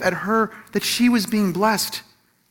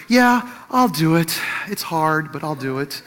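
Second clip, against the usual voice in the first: timid, tentative. Shown as a demonstration. Husband agrees to make her feel bad, timid and tentative. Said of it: "say it kind of timidly, like, tentatively".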